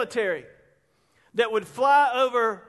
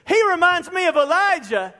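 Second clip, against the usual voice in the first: high, loud burst of wonder; exclaiming; 6 semitones higher, 6 dB louder than usual. Retold or imitated. People compare Jesus to Elijah, exclaimed high and loud. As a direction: proclaim it, raised and forceful.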